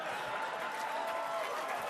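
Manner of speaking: quiet, unvoiced laugh to himself; soft chuckle at his own joke; breathy laugh. Real, not acted. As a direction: let the laugh slip out.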